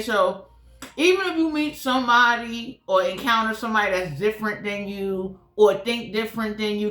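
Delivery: normal talking voice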